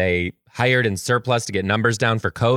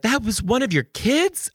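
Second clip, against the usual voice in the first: higher surprised question; mock surprised; 12 semitones higher than usual. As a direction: ask in amused disbelief. Voicing interviewers' surprise.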